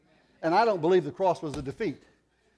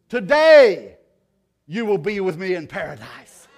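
loud proclamation; 3 semitones higher, 13 dB louder, slower than usual. Retold or imitated. Jesus' promise voiced.

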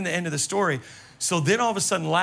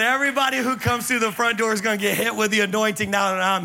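laughing; 5 semitones higher, 4 dB louder, faster than usual. Retold or imitated. Retelling woman's joke laughing.

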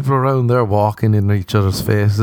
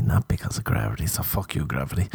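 hushed, breathy punchline; whisper; 5 semitones lower, 8 dB quieter than usual. Acted. Comic whispering the gravity punchline again. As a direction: let it drop into a whisper.

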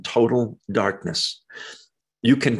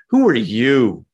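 quicker question with a punched YOU; stress on YOU; 4 dB louder than usual. Shown as a demonstration. Preacher repeating the Greek emphasis, stress on YOU. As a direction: hit "you" hard at the end. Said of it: "It's the emphasis word".

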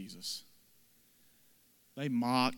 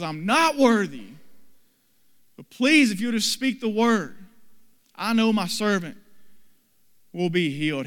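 humble plea; 6 semitones higher, 7 dB louder than usual. Acted. Humble centurion begs Jesus to heal.

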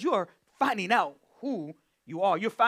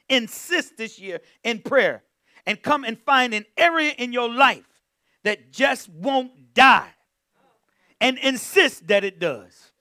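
louder, slowed preaching build; preaching at full force; 3 semitones higher, 6 dB louder than usual. Acted. Preacher exhorting congregation to insist.

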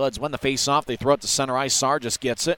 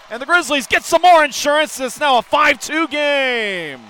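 shouted, high, drawn out; excited goal call; 12 semitones higher, 7 dB louder than usual. Real, not acted. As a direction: roar it and stretch the words. Fifth goal celebration.

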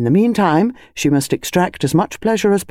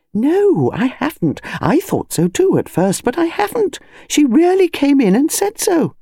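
high, indignant protest; a wife refusing to be told she dreamt it; insistent wife; 10 semitones higher than usual. Acted.

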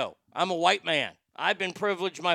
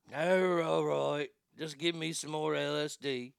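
put-on grudging British-accent surrender, softer; giving in, mock British; 2 semitones lower, 5 dB quieter than usual. Fred gives in to the bandmates, in a soft mock British accent. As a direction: cave in like a weary Liverpool rocker. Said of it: "Fred's like".

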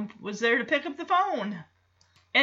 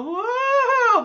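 high, drawn-out "woo"; long, high crowd cheer imitation; imitated audience whoop; 10 semitones higher, 3 dB louder than usual. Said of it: "you hear the audience go".

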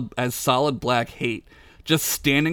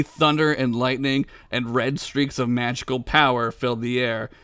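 normal talking voice

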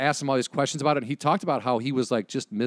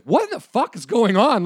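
higher, louder incredulous outburst; retold shock, pitched up and loud; stunned disbelief; 9 semitones higher, 6 dB louder than usual. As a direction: blurt it out in amazement. Retold disbelief at celebrity stories.

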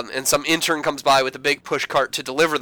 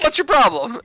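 much higher, louder indignant question; mock indignant Sam; 10 semitones higher, 4 dB louder than usual. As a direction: snap it up high, offended. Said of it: "he's like".

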